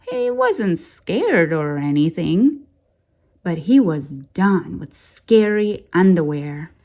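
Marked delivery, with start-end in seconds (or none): none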